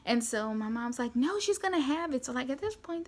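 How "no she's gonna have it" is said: higher-pitched, insistent retort; arguing mother. Retold or imitated. Mom arguing with the husband over the epidural, insistent. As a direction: imitate a mom digging in during an argument. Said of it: "they're, like, arguing right beside my bed".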